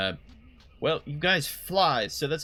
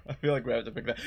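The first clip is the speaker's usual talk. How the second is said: ordinary talk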